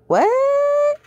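very high, disbelieving what; shocked squeak. Real, not acted. Reader reacts in disbelief.

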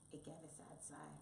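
soft, sympathetic narration; hushed sadness. A narrator's reading. Narrator tells of Mouse's sad sigh, softly. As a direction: drop softly on the sad moment.

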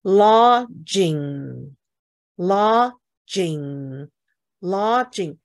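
teacher modelling "Lodging" for a student; pronunciation demo. Shown as a demonstration. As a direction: stretch "Lodging" syllable by syllable. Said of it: "就是我讲的那个后鼻音哈".

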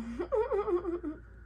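high, real burst of laughter at herself; laughing. Real, not acted. After boasting about singing, she laughs at herself. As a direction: laugh off the bragging. Laughs at her own joke.

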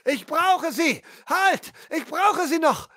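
high, urgent cry to stop them; pleading outcry; desperate shout. Acted. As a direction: throw the words out to halt the dagger. Hero shouts to stop sacrifice.

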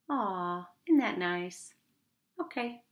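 ordinary delivery, nothing marked

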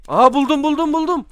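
high, loud, rapid repeats; excited discovery. Real, not acted. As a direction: shout out the find excitedly. Finds item, calls out excitedly. (heyecanla, tiz ve yüksek sesle)

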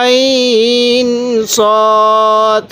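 আইন and সদ held long and slow; slow drawn-out আইন সদ in recitation; four-alif elongation. Shown as a demonstration. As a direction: stretch আইন সদ four counts each. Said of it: "যে হরফের উপর কলম রাখবো".

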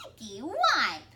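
put-on loud childish icky wipe; little girl voice.

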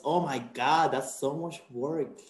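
mock dismay, drawn out; sarcastic. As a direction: fake shock at the workload. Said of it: "I'm being sarcastic".

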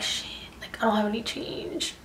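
whispering under the breath, unvoiced; retold whisper. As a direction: hush it like a panicked aside to yourself. Broke, panicking at the cash.

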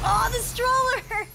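high, drawn-out excited cry; excited squeal. Real, not acted. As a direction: cry out in delight at the prize. Gift opens to a stroller.

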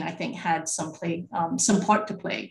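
ordinary talk, nothing marked

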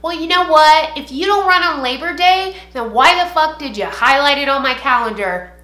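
loud, high, furious outburst; angry rant. Real, not acted. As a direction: vent straight at him, yelling.

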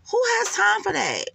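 a high, incredulous "Who has time for that?"; exasperated. Real, not acted. Exasperated high question about paying for Nesto's calls. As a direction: throw up your hands, pitched high.

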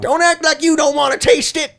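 high, insistent goading; hammed-up high taunt; teasing put-on voice. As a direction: pitch it way up and tease.

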